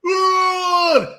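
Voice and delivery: high, drawn-out bellow; mock roar. Wrestler charging at his rival, high mock roar. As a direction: imitate a big wrestler's roar. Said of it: "And then Braun was like".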